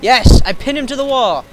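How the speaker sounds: loud, high victory yell; triumphant shout. Real, not acted. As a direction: cheer it out loud.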